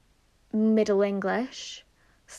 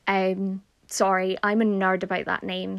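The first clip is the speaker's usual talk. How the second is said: normal talking voice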